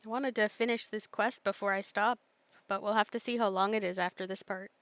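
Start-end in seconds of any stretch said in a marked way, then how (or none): none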